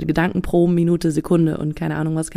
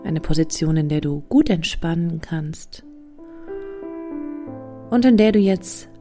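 guided meditation; slower than usual. Acted. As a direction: guide them into calm, slowly. (ruhige Meditationsstimme)

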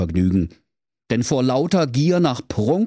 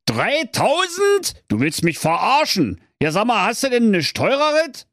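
outraged Kaiser; 12 semitones higher than usual. Acted. Kaiser empört über Preis.

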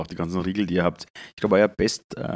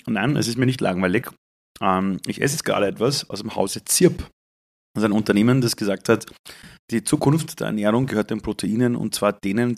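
usual speaking voice

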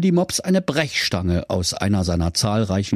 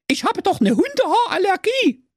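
very high whining protest; whiny sister. Acted. Sister protesting her allergy, very high whine. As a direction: whine like a little girl.

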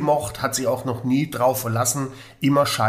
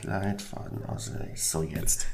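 whispered note; 8 semitones lower, 10 dB quieter than usual. Real, not acted. Muttering as he writes note.

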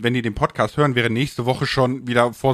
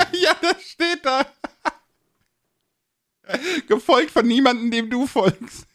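amused, high-pitched; 13 semitones higher, slower than usual. Real, not acted. Reads profile, amused.

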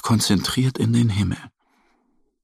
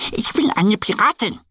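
much higher duck voice; proud little duck; 12 semitones higher than usual. Acted. Annie shows off her costume, high proud voice. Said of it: "erklärt Annie".

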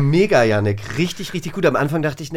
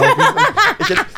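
laughing; 4 dB louder, faster than usual. Real, not acted. Laughs at own hard card.

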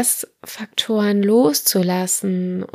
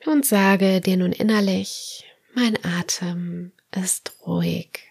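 guided meditation; slower than usual. Acted. Leads autogenic training relaxation.

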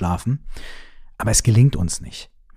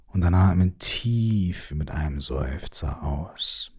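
guided sleep meditation; 3 semitones lower, 4 dB quieter than usual. Acted. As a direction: lull the listener gently toward sleep.

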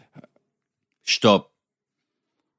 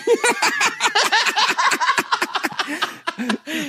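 high-pitched cackling laugh; real laughing fit. Real, not acted. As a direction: burst into helpless high laughter. Bursts out laughing.

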